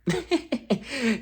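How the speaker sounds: high squealing laugh; high burst of laughter. Real, not acted. Laugh at clip.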